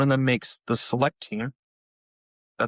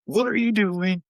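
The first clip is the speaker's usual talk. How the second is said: higher, louder frustrated parent; mock exasperation; 9 semitones higher, 3 dB louder, slower than usual. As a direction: imitate a fed-up dad in the stands. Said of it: "It used to be like".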